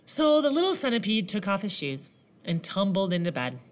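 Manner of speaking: plain reading voice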